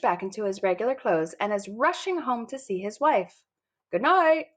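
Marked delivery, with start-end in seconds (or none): 3.9-4.5 high, bright-voiced goodnight; sing-song child goodnight; cheery goodnight. A narrator's reading. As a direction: chirp it out like a kid.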